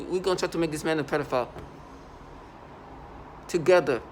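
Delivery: normal talking voice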